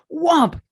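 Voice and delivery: sharp, high, loud imitated whomp; loud whomp sound. Telling the failed board break, loud imitated whomp. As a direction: slap out the impact noise.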